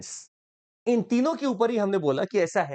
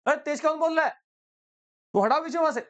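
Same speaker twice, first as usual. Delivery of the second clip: mock indignant; 7 semitones higher, faster than usual. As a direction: brush it off and repeat the order. Jaishankar denying shouting.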